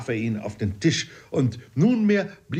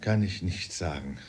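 low and soft, with a deep sigh; barely able to say it; quiet voice; 4 semitones lower, 6 dB quieter, slower than usual. A narrator's reading. Uncle Toby breaking news of a death, quietly. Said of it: "mit leiser Stimme".

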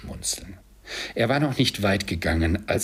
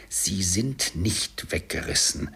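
usual speaking voice

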